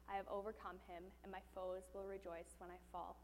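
quiet, measured reading of the psalm; scripture reading. Acted. Preacher reading Psalm thirteen aloud to the congregation, quiet and grave. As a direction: read it softly and gravely.

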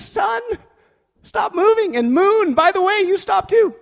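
high, commanding call to the sky. Retold or imitated. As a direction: order the sun to stop before all Israel. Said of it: "He raises his voice".

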